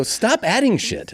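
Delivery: high, slow, exasperated yell; fed-up son shouting at his mother; upset yell. Retold or imitated. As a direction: spell it out to her, fed up. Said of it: "laughing that I'm getting upset".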